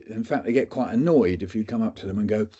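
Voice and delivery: ordinary talk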